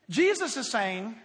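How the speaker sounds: ordinary delivery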